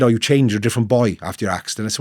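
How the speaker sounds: usual speaking voice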